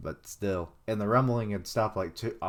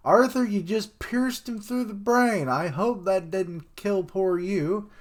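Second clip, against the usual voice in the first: a much higher, louder mocking voice, slow at the end; high taunting voice; 10 semitones higher, 5 dB louder than usual. Character mocking Arthur for piercing the brain, high and taunting. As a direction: sneer at him in a high put-on voice.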